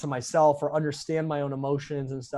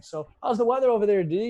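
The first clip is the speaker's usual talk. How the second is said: small talk; 7 semitones higher, 5 dB louder than usual. Retold or imitated. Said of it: "It's not like".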